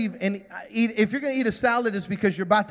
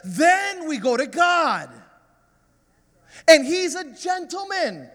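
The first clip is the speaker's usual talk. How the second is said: high, building preacher's cry; full-force preaching; 6 semitones higher than usual. Acted. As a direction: preach it high and emphatic to the congregation.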